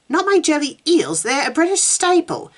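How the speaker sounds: high, dismayed protest. Acted. Susan defending her British food to Meg, high protest.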